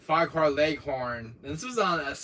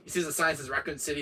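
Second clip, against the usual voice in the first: ordinary talk, nothing marked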